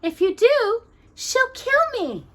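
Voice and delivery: high scared princess voice; frightened princess. A narrator's reading. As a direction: warn them fearfully.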